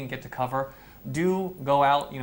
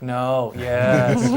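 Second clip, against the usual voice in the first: confused staff; 8 dB louder than usual. Retold or imitated. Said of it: "It's like".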